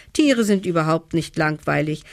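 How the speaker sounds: ordinary delivery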